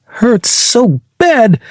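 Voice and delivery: high, slow pained wail; pained cry. Acted. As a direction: wail it through the sting. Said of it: "shook his hand while growling".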